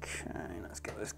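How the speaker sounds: whispered to himself; whispering. Real, not acted. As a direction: breathe it out unvoiced. Muttering while working.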